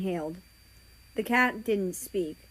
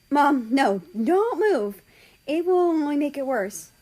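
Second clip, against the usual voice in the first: loud, high-pitched pleading; urgent alarm; 7 semitones higher, 7 dB louder than usual. Acted. Child stops injured mother getting up, loud urgent alarm.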